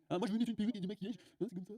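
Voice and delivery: a hint of a prissy young man; mock son's voice. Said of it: "C'est ça qu'il veut, lui".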